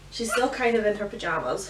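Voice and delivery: ordinary talk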